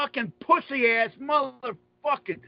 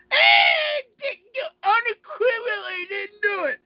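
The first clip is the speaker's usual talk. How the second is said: mock tearful denial; 9 semitones higher than usual. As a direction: ham up a blubbering, shouted protest. Mocking the judge's tearful denial.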